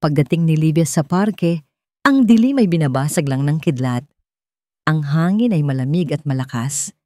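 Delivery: plain reading voice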